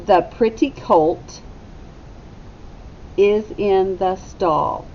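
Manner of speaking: slow, clear model sentence for the class. Shown as a demonstration. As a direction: say it slowly for children to repeat. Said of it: "Echo me".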